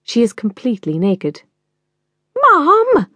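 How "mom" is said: shrill high scream of "Mom!", loud and sharp. Acted. Daughter screams at her naked mother on set. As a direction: scream it at your mother in shock. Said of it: "Ali screams".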